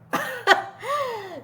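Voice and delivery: a burst of real high laughter; breaking into a high giggle. Real, not acted. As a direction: laugh out at how absurd it was. Laughing at own story.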